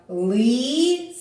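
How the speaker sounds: «Лисиця» split into long separate syllables; по складах. Shown as a demonstration. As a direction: sound out «Лисиця» syllable by syllable, with gaps. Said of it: "Давайте промовимо по складах". (протяжно, по складах)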